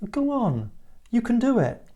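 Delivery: high, gentle encouragement; encouraging. Shown as a demonstration. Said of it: "to encourage, and it's a s-, might have a softer verb".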